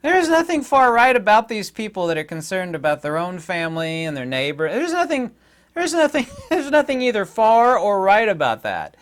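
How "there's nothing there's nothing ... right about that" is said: high, loud, laughing disbelief; can't believe it, laughing it off; incredulous. Real, not acted. Scoffing at calling worried parents far-right, laughing.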